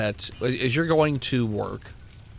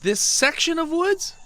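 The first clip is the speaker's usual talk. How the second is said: incredulous. Acted. Sheriff incredulous at burn plan.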